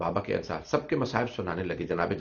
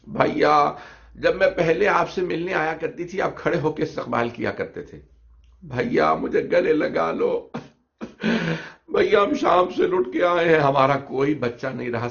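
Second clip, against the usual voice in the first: loud, much higher wailing cry; keening lament; 7 semitones higher, 6 dB louder than usual. Acted. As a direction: sob it out over the grave. Zainab laments at brother's grave. (भाई की कब्र पर ऊँची आवाज़ में रोती बहन का विलाप)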